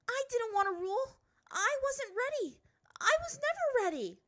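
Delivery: put-on high, loud girl's shout. A narrator's reading. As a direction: defy her father at full height. Ty Lee shouts at father.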